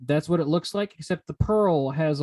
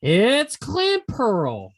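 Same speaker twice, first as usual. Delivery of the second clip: slow, loud, high reveal; dramatic reveal; 7 semitones higher, 5 dB louder, much slower than usual. Acted. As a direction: drag it out like a big reveal. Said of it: "reveal of the Pokemon".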